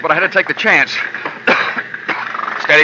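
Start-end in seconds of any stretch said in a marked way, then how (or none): 1.0-2.6 harsh dry cough from the smoke. Acted. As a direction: choke on the smoke. Smoke-filled stall.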